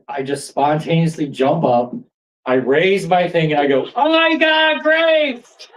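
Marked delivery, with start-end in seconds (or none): 4.0-5.7 high, elated cry to a whole crowd; excited shout. Retold or imitated. Man jumps up on the lawn to announce his grapes, excited high shout. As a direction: call it out high, bursting with discovery.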